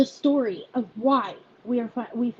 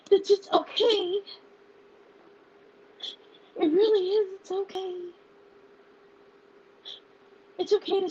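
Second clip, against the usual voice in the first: higher, choked-up voice, pausing to hold back tears; real tearful voice; 7 semitones higher than usual. Real, not acted. Founder telling followers they're not alone, tearful. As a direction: fight through tears to get it out. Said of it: "I am an emotional wreck".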